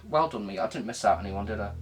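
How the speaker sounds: ordinary talk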